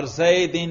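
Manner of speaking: زیدن said as zaydin, ending "-in"; genitive ending. Shown as a demonstration. Teacher quizzes students on the case heard, زیدن pronounced zaydin. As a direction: close زیدن on a clear i-n sound. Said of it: "جر".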